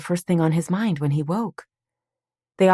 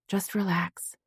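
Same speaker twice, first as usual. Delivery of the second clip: soothing; 4 semitones higher, 6 dB quieter, slower than usual. Acted. Soothing patient, much softer.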